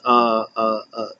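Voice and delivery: ordinary delivery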